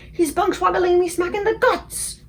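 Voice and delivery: high, loud angry shout. A narrator's reading. Kicked giant shouting in outrage, loud and high. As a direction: bellow the complaint. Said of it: "shouted the gizzard gulper".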